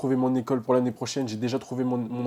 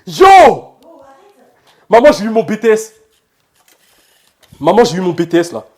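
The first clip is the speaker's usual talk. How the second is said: much higher and louder, yelling the news to mom; shouting with joy; 7 semitones higher, 18 dB louder than usual. Real, not acted. Student yells to his mother that he passed, joyful shout. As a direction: shout it across the house, thrilled. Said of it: "Arrête de crier comme ça".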